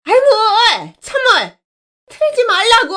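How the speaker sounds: bully shrieking at the top of her voice; screaming at the top of lungs. Acted. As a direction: scream it out in rage. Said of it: "고래고래 소리를 질렀습니다".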